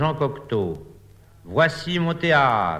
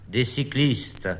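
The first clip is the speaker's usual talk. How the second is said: plain reading voice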